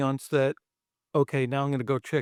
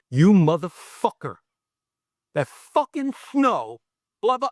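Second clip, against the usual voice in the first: louder drawn-out curse, then higher pitch; retold yelling; 4 semitones higher, 4 dB louder than usual. Director cursing the actor about the snow, imitated yelling. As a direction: imitate an outraged boss cursing someone out slowly. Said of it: "he's fucking yelling at me".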